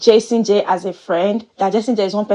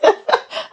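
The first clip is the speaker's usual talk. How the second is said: high burst of laughter. Real, not acted. Laughing at her own joke.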